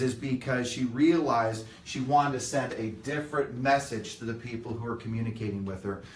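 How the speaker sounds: ordinary talk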